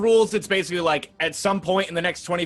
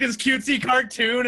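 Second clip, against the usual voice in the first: usual speaking voice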